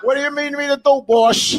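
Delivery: high, loud goon voice; eager henchman asking the boss; high-pitched eager Italian mob flunky. Pretend. Voicing henchman in skit.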